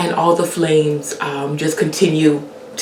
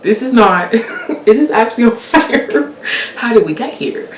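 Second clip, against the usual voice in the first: laughing disbelief; 6 semitones higher than usual. Retold or imitated. Disbelieving at the pan on fire, laughing. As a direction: laugh it off in shock.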